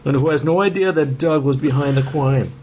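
put-on reading the blurb in a Boston accent. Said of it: "Could you do this in a Boston accent".

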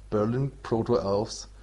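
usual speaking voice

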